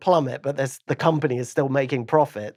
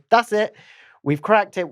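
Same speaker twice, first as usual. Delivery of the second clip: higher, louder triumphant burst; imagined eureka moment, pitched up; acted announcement; 7 semitones higher, 4 dB louder than usual. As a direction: announce a sudden breakthrough. Acts out breakthrough announcement.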